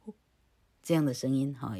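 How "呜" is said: tiny 呜 with just a little voice added; a very short, faint 呜; 呜 as a minimal audible puff; barely voiced 呜. Shown as a demonstration. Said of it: "所以老柯加了一点点".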